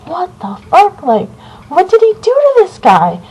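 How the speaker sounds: high, incredulous what-the-hell; shocked disbelief. Retold or imitated. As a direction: recoil in disbelief at the sight. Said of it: "And I was like".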